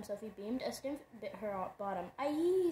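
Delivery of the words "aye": high, loud yelp of pain; startled yelp from a bite. A narrator's reading. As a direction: yelp as if bitten. Bitten on the bottom.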